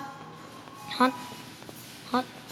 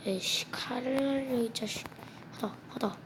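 cursing under breath; 11 dB quieter than usual. Real, not acted. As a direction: curse quietly at the enemy. Cursing at game boss. (작게 중얼거리는 욕)

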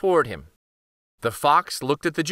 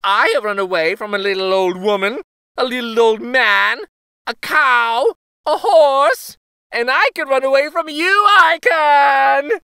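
high, loud and fast taunt; laughing gingerbread boy; 9 semitones higher, 7 dB louder than usual. A narrator's reading. Gingerbread boy taunting the cow, high fast bragging. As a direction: brag quickly with a laugh. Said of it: "he cried out".

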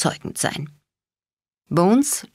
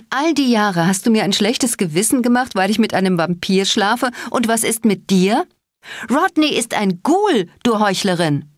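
higher, heated reproach; indignant accusation; 6 semitones higher than usual. A narrator's reading. Daughter scolds her mother as a hypocrite, heated. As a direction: throw the hypocrisy back at her.

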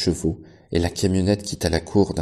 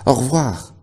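called goodbye; 7 semitones higher, 6 dB louder than usual. A narrator's reading. Animals calling farewell.

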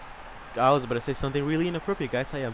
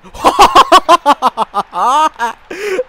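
long, high, loud burst of laughter; ecstatic scream-laugh; 12 semitones higher, 13 dB louder than usual. Real, not acted. Gamer celebrating a beautiful goal, ecstatic high laughing. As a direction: explode into high, loud cackling.

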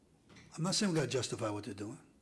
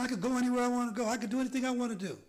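higher, fast, lightly voiced teenage girl; lightly voiced girl boasting; a hint of a girl's voice; 11 semitones higher, 3 dB louder, much faster than usual. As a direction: rush it out like a restless girl. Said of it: "She said".

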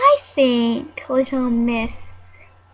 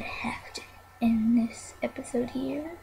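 soft breath-only sign-off; whisper; 11 dB quieter than usual. Real, not acted. Ending the episode for viewers, whispering. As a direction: whisper the goodbye.

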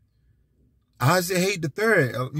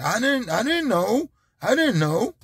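mock clueless excuse; 8 semitones higher than usual. Mocking ignorant excuse.

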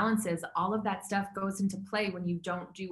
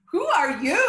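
mock disbelief; 7 semitones higher, 9 dB louder than usual. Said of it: "I'm like".

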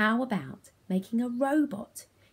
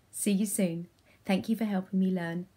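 ordinary talk

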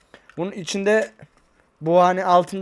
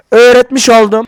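loud, proud declaration; shouting; 4 semitones higher, 12 dB louder than usual. Real, not acted. Vlogger proudly announces what viewers learned, loudly. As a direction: boom it out triumphantly. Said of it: "Bağırma lan milletin içinde".

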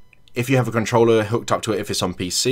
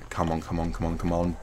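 tense under breath; 4 semitones lower, 9 dB quieter than usual. Real, not acted. Hiding, tense.

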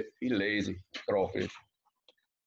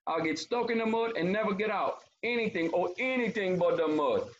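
high, pleading storybook voice; theatrical crawfish pleading; begging crawfish; 8 semitones higher than usual. A narrator's reading. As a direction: beg in a high voice, hammed-up dread. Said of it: "Crawfish begging him".

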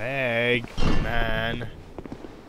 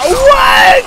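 sudden loud high yelp; real startled cry; shocked shout; 17 dB louder than usual. Real, not acted. Shocked by enemy.

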